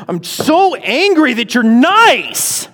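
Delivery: put-on high, loud, slow childish rage; mock fury. Teacher voicing Jonah's tantrum, a hammed-up furious whine. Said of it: "he's furious when he's saying these things".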